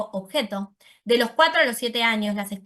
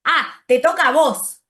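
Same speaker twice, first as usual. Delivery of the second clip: put-on "Ah, te toca a vos" slower and louder, like a small child repeating; a child parroting the phrase, slow and loud; child's literal echo; 4 semitones higher, 7 dB louder than usual. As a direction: echo it back slowly, as a toddler would. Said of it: "ellos dicen".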